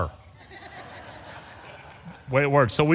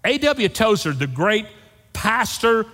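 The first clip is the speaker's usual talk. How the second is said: ordinary talk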